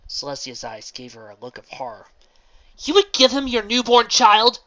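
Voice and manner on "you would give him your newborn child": high, loud horrified question. Acted. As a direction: recoil in horror as you ask.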